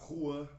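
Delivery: Juan as HWAN, Spanish style. Shown as a demonstration. Teacher comparing names across languages, Spanish Juan. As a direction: give Juan its Spanish sound. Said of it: "Lá você vai pronunciar".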